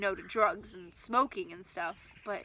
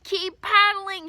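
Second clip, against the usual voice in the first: loud, slow, much higher command; shouting; 10 semitones higher, 11 dB louder, much slower than usual. Acted. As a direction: bark the order over the storm. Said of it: "he shouted".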